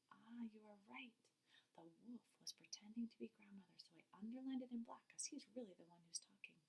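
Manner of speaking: soft whispered aside to the class; hushed teacher whisper. Real, not acted. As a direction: lean in and whisper the explanation.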